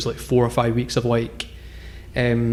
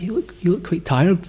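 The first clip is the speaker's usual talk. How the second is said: polite pestering; 6 semitones higher than usual. Retold or imitated. Traveller working on a reluctant bus passenger, politely pestering. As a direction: nudge him gently, voice pitched well up. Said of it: "really politely harassing this guy".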